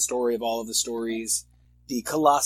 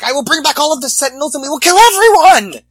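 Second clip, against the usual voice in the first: much higher, mock-furious yell; hammed-up villain threat; 13 semitones higher, 12 dB louder, faster than usual. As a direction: yell it like an over-the-top raving villain. Voicing the mutant-hating humans' threat.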